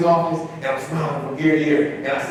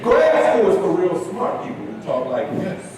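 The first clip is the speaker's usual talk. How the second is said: ordinary talk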